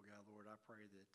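normal talking voice

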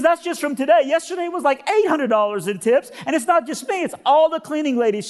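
high-pitched overwrought woman; high, worked-up put-on voice; emotional cleaning lady. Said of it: "I'm sorry to be emotional".

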